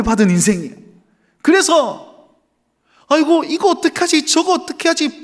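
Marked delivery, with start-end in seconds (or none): 3.1-5.2 higher, worried hand-wringing voice; a hammed-up panic over what to do; mock anxious fretting. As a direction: act out a fretful worrier, pitched up. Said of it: "불안해하며 사는 인생".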